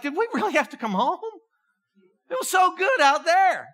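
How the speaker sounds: a hint of Jesus wishing he'd stayed up there; wistful Jesus. Jesus voiced wishing for mountaintop.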